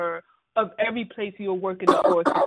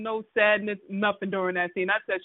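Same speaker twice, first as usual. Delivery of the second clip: ordinary talk, nothing marked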